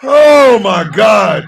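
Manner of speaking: slow, loud, laughing out each word; overwhelmed by the joke; laughing loudly. Real, not acted. Laughing at the movie.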